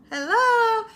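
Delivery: high, laughing hello; laughing call. Retold or imitated. Calling to the ghost while laughing. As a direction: call out through giggles.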